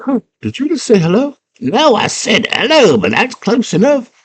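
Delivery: put-on high girl voice. Girl meets worm.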